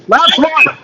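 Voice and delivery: rising excitement on the goal; excited goal call. Real, not acted. Goal call in live commentary.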